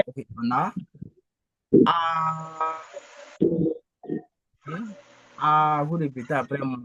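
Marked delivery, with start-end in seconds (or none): 1.9-2.8 "a" held long on one level pitch; even, sung-out "a"; constant first tone. Shown as a demonstration. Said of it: "du ton constant". 5.4-5.8 "a" held long on one level pitch; even, sung-out "a"; constant first tone. Shown as a demonstration. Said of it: "du ton constant".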